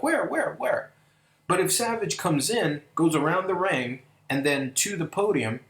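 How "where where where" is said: eager searching. Retold or imitated. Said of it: "everybody would be like".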